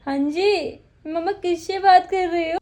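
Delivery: put-on high, loud baby-voice question; mother voicing her baby girl, high and cute. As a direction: speak for the baby in a squeaky little voice. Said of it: "उसको हो रहा है कि".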